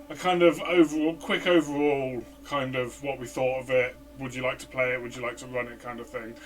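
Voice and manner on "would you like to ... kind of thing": usual speaking voice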